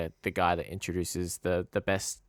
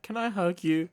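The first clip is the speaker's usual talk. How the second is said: a pleading fan voice, pitched way up; mock pleading voice; 11 semitones higher than usual. Imitating Jack asking a stranger for a hug, high and pleading. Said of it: "'cause he's like".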